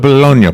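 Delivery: Bologna as "buh-LOHN-yuh" in a mock order; Italian-style pronunciation. Pretend deli customer asks for a pound, Bologna as "buh-LOHN-yuh". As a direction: order Bologna with a "-nya" ending. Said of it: "Should we be saying it's Bologna?".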